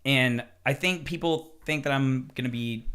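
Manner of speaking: usual speaking voice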